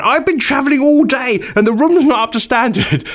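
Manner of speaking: high, haughty mock outrage; hammed-up upper-class whine, escalating; posh woman, worsening. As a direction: complain like an indignant posh guest. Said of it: "getting worse and worse".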